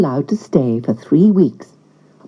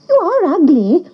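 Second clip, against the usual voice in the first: high, mocking duck voice; a wild duck sneering at the duckling; 11 semitones higher than usual. A narrator's reading. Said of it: "said the wild ducks".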